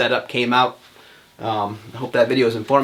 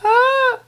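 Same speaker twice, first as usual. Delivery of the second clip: startled yelp; 8 dB louder than usual. Real, not acted. Startled as the light flashes.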